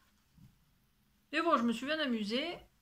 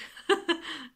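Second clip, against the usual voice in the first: higher, louder burst of laughter; amused laugh; 5 semitones higher, 5 dB louder than usual. Real, not acted. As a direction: crack up at your own slip. Laughs at her own slip.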